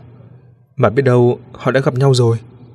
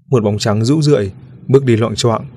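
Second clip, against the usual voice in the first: ordinary delivery, nothing marked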